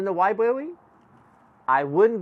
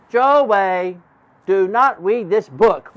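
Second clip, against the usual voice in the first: louder, slow, wide-eyed reading; alarmed repeat; 3 semitones higher, 7 dB louder, slower than usual. Acted. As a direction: repeat the warning louder and slowly.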